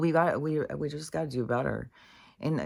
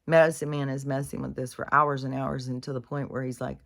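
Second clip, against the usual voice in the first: usual speaking voice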